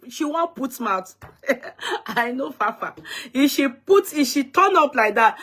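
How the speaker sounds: normal talking voice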